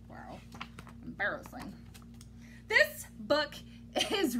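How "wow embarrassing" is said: embarrassed murmur. Real, not acted. Embarrassed aside.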